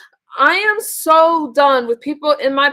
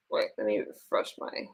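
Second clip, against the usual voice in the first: whispered aside to herself; whispering; 15 dB quieter than usual. Real, not acted. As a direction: breathe it under your breath. Muttering to herself.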